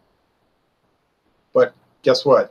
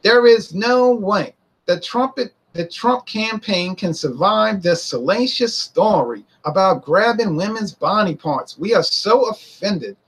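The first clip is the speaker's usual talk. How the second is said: higher, slow, smug newsreader voice; gleeful mock anchor; 6 semitones higher, slower than usual. Pundit mocking gleeful CBS anchors, higher and slow. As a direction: savour the scandal in a lifted, slow drawl. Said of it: "They were so gleeful".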